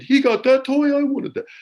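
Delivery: put-on high, loud childish whine; whiny kid. Guest imitates a jealous child wanting a toy, high whiny voice. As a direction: play a jealous little kid. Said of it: "like any other kid did".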